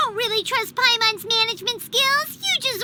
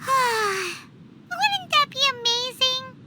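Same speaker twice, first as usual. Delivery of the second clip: lost in the fantasy; dreamy sigh; much slower than usual. Acted. Paimon sighing over her dream, dreamy.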